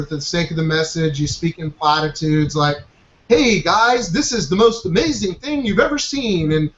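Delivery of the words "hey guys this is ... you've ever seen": hyped-up TV announcer pitch, higher; commercial announcer voice. Retold or imitated. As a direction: sell it like a cheesy commercial announcer. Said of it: "talk like a freaking newscaster or a commercial announcer".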